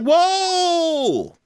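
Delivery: ordinary talk, nothing marked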